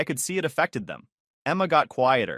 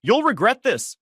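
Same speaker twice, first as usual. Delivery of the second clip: much higher, loud shout after them; calling out; 11 semitones higher, 6 dB louder than usual. Acted. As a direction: yell it at their backs across the room. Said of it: "I hear my mom call out".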